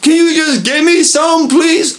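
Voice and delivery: high pleading voice; whiny begging. Retold or imitated. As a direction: beg shamelessly, stretching please.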